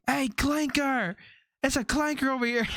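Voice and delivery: hammed-up taunt called out to a passing streamer; teasing shout, pitched high; mock yell. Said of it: "they yelled out to Lacey".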